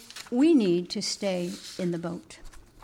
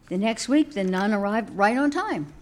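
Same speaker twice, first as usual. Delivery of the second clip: ordinary talk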